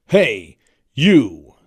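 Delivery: loud, sudden call-out; man's voice calling. Retold or imitated. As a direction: bellow it like the singer in the intro. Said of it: "a man's voice goes".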